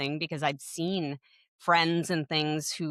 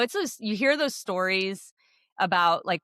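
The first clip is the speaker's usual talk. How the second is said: ordinary talk, nothing marked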